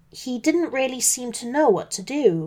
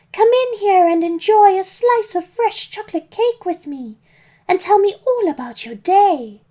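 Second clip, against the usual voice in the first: high, loud, welcoming call; shouting mum; 10 semitones higher, 6 dB louder than usual. A narrator's reading. Mum inviting wolf in for cake, calling out. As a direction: call him in with a pitched-up mum voice. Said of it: "shouted my mum".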